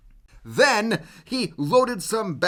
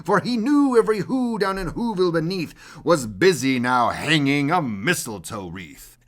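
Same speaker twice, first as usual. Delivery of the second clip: plain reading voice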